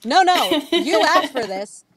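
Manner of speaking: wag a finger at him, high and sharp; mock scolding. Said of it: "I'm like".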